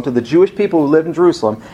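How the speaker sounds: ordinary talk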